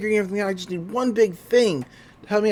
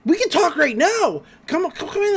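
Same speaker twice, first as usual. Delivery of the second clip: put-on high-pitched, excited kid; over-eager urging; 11 semitones higher than usual. Dipper coaxing the agents inside, acted over-eager urging. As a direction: usher them in with high, hurried eagerness.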